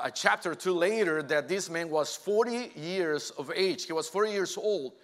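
ordinary delivery

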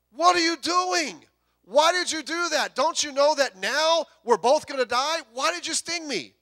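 high, loud, frantic questions as the poison takes hold; a betrayed frog shrieking in disbelief; frog's panicked cry. Acted. Preacher voicing the stung frog crying out at the scorpion, high and panicked.

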